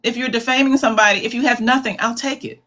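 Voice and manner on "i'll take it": usual speaking voice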